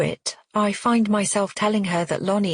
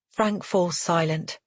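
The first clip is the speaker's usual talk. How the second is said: ordinary delivery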